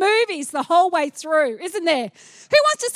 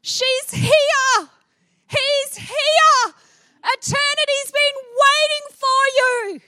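Jesus welcoming someone home to heaven, overjoyed; joyful welcome; 8 semitones higher, slower than usual. Pretend. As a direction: announce the arrival with glee. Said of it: "He is gonna be like".

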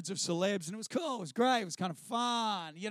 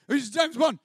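weird flustered blurt; 6 semitones higher, 8 dB louder, slower than usual. Retold or imitated. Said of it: "And I did this weird".

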